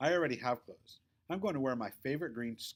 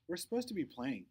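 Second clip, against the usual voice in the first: ordinary delivery, nothing marked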